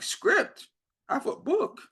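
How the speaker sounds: high, incredulous yelp at the word script; shocked disbelief. Retold or imitated. Told they want a script, replies in high shocked disbelief. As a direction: squeak it out like you can't believe the ask.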